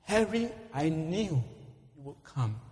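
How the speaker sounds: halting, falling away at the end; dying man's words. Acted. Dying Bill speaks to rescuer Harry, fading last words. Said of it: "In his dying moments".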